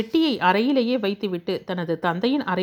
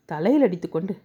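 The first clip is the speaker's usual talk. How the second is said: ordinary delivery, nothing marked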